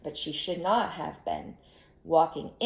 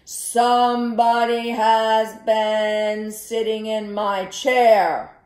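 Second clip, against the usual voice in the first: loud, slow booming bear; great big papa bear voice; 3 semitones higher, 10 dB louder, slower than usual. A narrator's reading. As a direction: boom it out slowly as a huge bear. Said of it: "he said in his great big voice".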